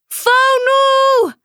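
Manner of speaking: loud, high call across the water; shouted name. A narrator's reading. Girl spotting her trapped turtle, shouting loudly. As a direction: yell her friend's name in alarm. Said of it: "schreeuwde ze".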